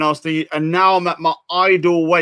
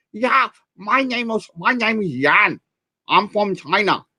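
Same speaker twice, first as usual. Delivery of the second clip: 1 bad Chinese accent; 4 semitones higher than usual. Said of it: "I can't do a Chinese accent".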